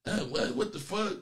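breathy mock whisper of her reaction; mock-quoting the caller's huffy retort; mocking imitation of her. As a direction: mimic her snippy comeback, under the breath. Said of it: "You wanna try to say something".

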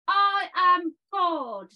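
slow, loud proclamation; triumphant shout. Acted. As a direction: proclaim it slowly like a god. Climax of the poem.

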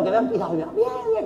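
laughing through the words. Real, not acted. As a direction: giggle it out with glee. Laughing on the phone to mum. (riendo a carcajadas)

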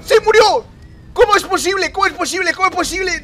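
high, loud outraged disbelief; real shocked outcry; stunned disbelief. Real, not acted. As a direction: cry out at losing at the last second. Loses at last minute.

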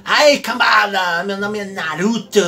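mock a rude self-introduction; rude anime intro. Teacher mocking a rude anime intro, brash. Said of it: "uma forma feia de falar".

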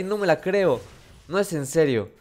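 ordinary talk

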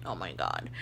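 low, soft dismayed oh my God; hushed horror. Retold or imitated. Stylist realizing the mistake, low and soft with dismay. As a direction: breathe it out, mortified.

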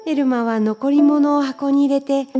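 ordinary delivery, nothing marked

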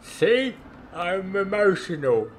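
high, slow hammed-up emotion; mock emotional. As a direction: play at being moved, high and drawn out. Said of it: "It's made me a little bit emotional".

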